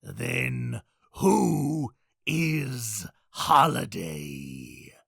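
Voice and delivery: very slow, spaced-out question; menacing demand. Acted. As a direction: draw out every word as a threat. Clown demands the killer's name.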